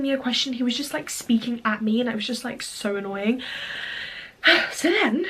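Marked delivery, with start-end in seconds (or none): 3.5-4.7 audible breathy sigh of annoyance; exasperated sigh. Real, not acted. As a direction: let out a fed-up sigh. Annoyed by his talking.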